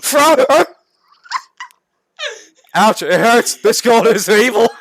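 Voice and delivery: a hint of cartoon pain, played loud; hammed-up pained character. Character hurt by stinging gold.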